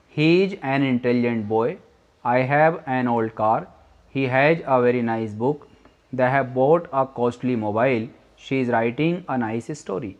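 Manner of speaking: very slow, word by word example sentences; slow dictation. Shown as a demonstration. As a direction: read each example slowly for students. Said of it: "आ का use कर रहे हैं।". (बहुत धीमे, शब्द-दर-शब्द उदाहरण पढ़ना)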